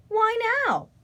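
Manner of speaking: high, plaintive cry; upset cry. A narrator's reading. Young scarecrow protesting to his parents, high dismayed cry. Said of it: "he cried".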